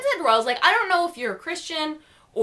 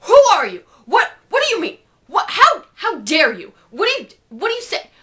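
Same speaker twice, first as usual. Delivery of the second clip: indignant shouting; 6 semitones higher, 6 dB louder than usual. Retold or imitated.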